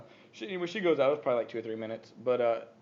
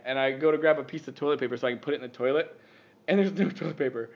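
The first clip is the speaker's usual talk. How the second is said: usual speaking voice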